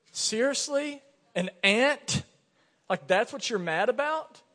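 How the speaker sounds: high, incredulous mock taunt. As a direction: taunt a child over a silly complaint. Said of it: "I was kinda taunting her".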